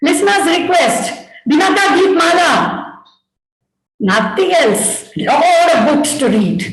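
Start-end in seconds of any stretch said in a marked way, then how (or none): 0.1-2.5 high, projected announcer voice; radio announcer. Retold or imitated. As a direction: announce it like an old radio show. Imitates a radio programme announcer.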